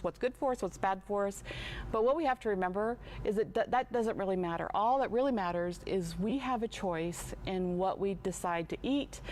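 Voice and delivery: normal talking voice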